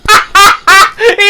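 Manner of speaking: high loud laughter. Real, not acted. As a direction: burst out laughing. Laughing at the story's fall.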